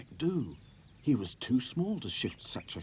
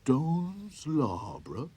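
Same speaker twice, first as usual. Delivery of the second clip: puzzled; 6 semitones higher, 4 dB louder than usual. A narrator's reading. Sad Sack repeats, puzzled.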